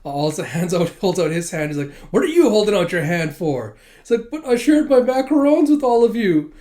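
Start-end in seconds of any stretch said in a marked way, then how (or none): 2.1-3.8 raised, louder indignant question; indignant demand. Acted. Damien snapping at Anya on the bus, indignant and loud. Damien scolds Anya's outstretched hand. 4.3-6.5 much higher, louder wounded protest; whiny protest. Acted. Damien complaining he shared his macarons, high loud whine.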